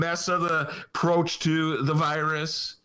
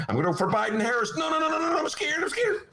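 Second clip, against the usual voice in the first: fast, higher, yelling mock panic; faster than usual. Host imitating a frightened voter in the booth, panicked. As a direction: squeal like a scared voter changing his mind. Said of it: "here's Dennis's hand in the voting booth".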